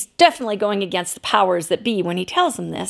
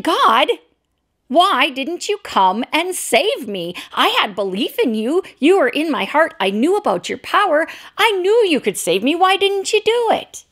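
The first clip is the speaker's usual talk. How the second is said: rising, higher-pitched complaint; mildly angry plea that builds to the end; 6 semitones higher than usual. Retold or imitated. Man in heaven complains angrily to God. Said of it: "the man greeted God very angrily".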